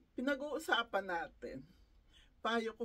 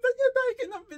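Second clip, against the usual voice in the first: laughing hard; 13 semitones higher, 12 dB louder, faster than usual. Real, not acted. Laughing while retelling mother's temper.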